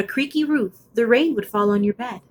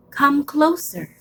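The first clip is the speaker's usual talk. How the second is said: beckoning dark voice; 6 semitones higher, slower than usual. Acted.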